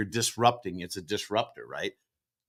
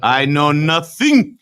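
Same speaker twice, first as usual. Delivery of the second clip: Sergeant Schultz impression; 4 semitones higher, 6 dB louder, much slower than usual. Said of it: "Hogan's Hero".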